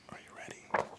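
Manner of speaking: breathy unvoiced whisper; whispered question. A narrator's reading. Mysterious voice whispers to the witch. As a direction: whisper it out of nowhere, spooky. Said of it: "Then a voice said".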